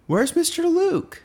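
put-on very high, drawn-out little-kid voice; slow, high child's plea; whiny child. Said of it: "every child walks up to me and asks".

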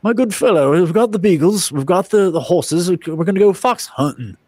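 put-on high English gentleman accent, rather grand; mock upper-class Englishman on the fox hunt; English toff accent. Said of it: "they're like".

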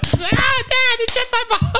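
very high mock child voice crying out; squealing little boy. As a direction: yelp like a child being smacked, hammed up. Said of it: "making smacking noise".

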